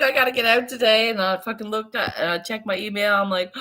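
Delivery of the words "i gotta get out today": quick, louder urgent line; itching to escape the house; eager burst. Retold or imitated. Talking to herself, stuck at home, a quick eager burst. Retelling own restless urge.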